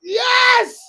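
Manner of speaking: high loud cheer; excited shout. Real, not acted. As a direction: cheer at the big spot. Big spot, both yelling.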